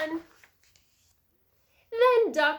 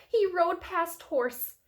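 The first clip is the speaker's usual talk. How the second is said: ordinary delivery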